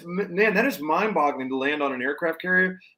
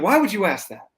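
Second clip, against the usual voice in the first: higher, louder, playfully evasive; mock-defensive pilot; 6 semitones higher, 4 dB louder than usual. Pilot asked about the hands-free landing, mock defensive. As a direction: play innocent, a bit too fast.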